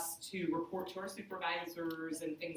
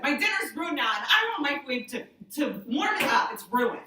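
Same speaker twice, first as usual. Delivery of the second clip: whiny complaint; 6 semitones higher, 8 dB louder than usual. Retold or imitated. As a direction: moan it like a spoiled meal is a tragedy. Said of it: "That's what I said".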